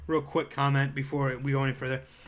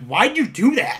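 upset; 7 semitones higher, 10 dB louder than usual. Retold or imitated. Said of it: "I'm like".

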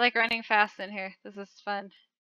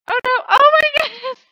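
high, loud cry breaking into laughter; laughing shout; 13 semitones higher, 10 dB louder than usual. Real, not acted. Player dying again, shouting and laughing. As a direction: yell then crack up.

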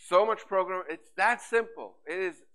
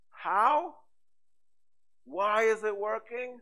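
high rhetorical how; 6 semitones higher, 5 dB louder, slower than usual. Real, not acted. Rhetorical question to audience.

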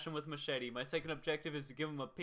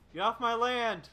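grumpy landowner; 5 semitones higher, 4 dB louder than usual. Pretend. Killer scolding survivor.

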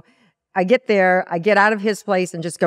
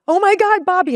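high, loud startled cry; excited; 12 semitones higher, 5 dB louder than usual. Retold or imitated. Waking from the vision, crying out to Bobby with joy. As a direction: wake him with the news, overjoyed.

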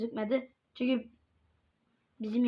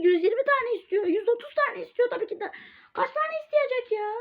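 1 mock teacher; 11 semitones higher than usual. Mocking teacher's demands.

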